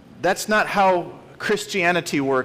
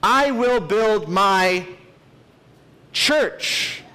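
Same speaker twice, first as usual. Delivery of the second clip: slow lead-in, pause, then "church" set apart; stress on CHURCH; 4 semitones higher, much slower than usual. Shown as a demonstration. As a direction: hold back, then deliver "church" alone after a long pause. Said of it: "putting emphasis on each word every time".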